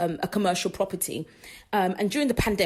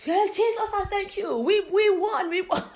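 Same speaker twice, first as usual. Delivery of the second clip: praising mother; 11 semitones higher than usual. Retold or imitated. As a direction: imitate a rejoicing mum, much higher. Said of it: "her mum was there going".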